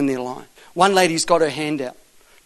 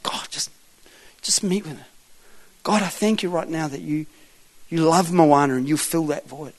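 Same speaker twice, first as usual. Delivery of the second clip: re-enacted prayer; 6 dB quieter than usual. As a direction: pray quietly and gently over someone. I just pray.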